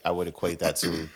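normal talking voice

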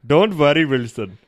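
loud mock Indian accent. Player starts a Castaway quote in a loud Indian accent. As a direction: launch loudly into an Indian accent.

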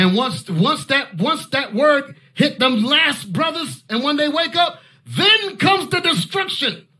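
slow, rising to a high drawn-out cry; preaching crescendo about destruction; building preacher's proclamation. Acted.

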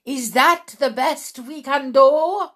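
high, loud scolding question; scolding witch. A narrator's reading. Witch leader berates her audience, high and loud. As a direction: demand it like a tyrant witch.

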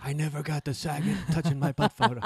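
high, put-on wistful lament; mock dying Brandy. Joking about Brandy on her deathbed, mock lament. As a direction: mourn it like a last regret.

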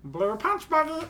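high slow cartoon alien voice; Stitch impression. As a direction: garble it like Stitch. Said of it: "I still have a killer Stitch".

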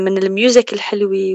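normal talking voice

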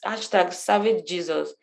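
normal talking voice

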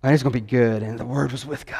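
mock-solemn recital of John one; solemn scripture reading voice. Shown as a demonstration. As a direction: intone the verse like a grand reading. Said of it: "I don't know why I'm talking like that".

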